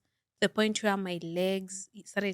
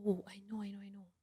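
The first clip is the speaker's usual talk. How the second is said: whisper; 13 dB quieter, faster than usual. Real, not acted.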